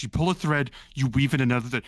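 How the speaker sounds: usual speaking voice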